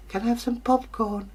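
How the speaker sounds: put-on high, childish request; lightly voiced daughter. Daughter asking her father for popcorn, high and childish. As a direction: ask sweetly like a spoilt child.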